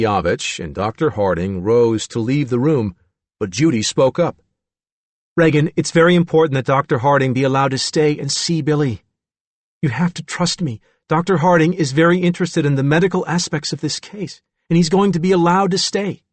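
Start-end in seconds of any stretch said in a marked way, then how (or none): 5.4-16.2 lightly voiced, higher-pitched plea; a hint of urgent persuasion; earnest woman persuading. A narrator's reading. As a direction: press the case in a raised character voice. Judy, character, speaks up.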